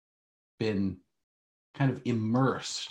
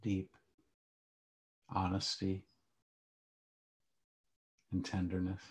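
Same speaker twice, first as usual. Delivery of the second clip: calm grounding exercise; 4 dB quieter than usual. Acted. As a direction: guide them gently into stillness. Leads a guided meditation.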